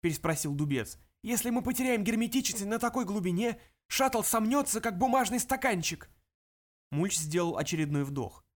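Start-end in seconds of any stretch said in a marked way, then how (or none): 1.2-6.1 alarmed pilot. Acted. Pilot warns of collapse.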